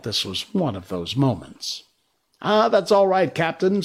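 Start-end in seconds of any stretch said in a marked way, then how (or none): 2.4-3.8 high, easy, pleased with himself; complacent. Acted. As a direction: brush it off cheerfully. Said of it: "Smee answered complacently".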